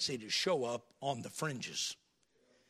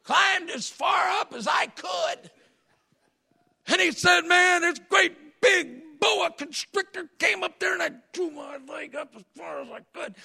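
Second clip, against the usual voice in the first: excited shouting; 12 semitones higher, 7 dB louder than usual. Retold or imitated. Preacher acting out Vineyard's boa story, shouting. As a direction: shout the climb up the tree.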